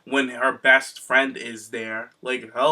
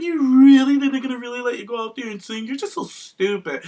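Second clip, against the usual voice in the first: much higher, louder mimicry of the taunt; mocking high voice; 9 semitones higher, 6 dB louder than usual. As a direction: parody a sneering mean girl. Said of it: "'Cause she's like".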